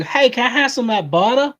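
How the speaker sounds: put-on high, loud Southern accent. Co-host begging for butter, hammed-up Southern accent. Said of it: "really good with the Southern accent too".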